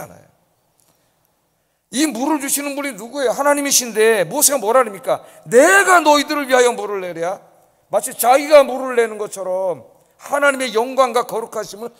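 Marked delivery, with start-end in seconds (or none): 5.5-7.4 loud proud claim, stressing 내가; angry boast. Retold or imitated. Stress on 내가 as Moses. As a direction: Moses claiming the water as his own, loudly. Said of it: "자기가 화가 나가지고".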